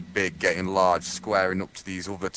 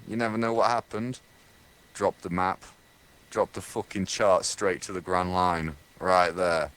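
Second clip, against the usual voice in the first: ordinary talk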